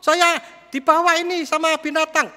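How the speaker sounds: put-on high, loud child's cry for mama; child screaming for help. Child carried off by a deer calls his mother, high panicked cry. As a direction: cry out like a terrified kid being carried off. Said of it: "anak ini teriak-teriak".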